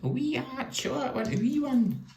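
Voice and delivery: high squeaky cartoon voice, far above his own; Whiskey and Brandy Bollins voice. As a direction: pitch it way up like a cartoon brother. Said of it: "they talked a bit like that".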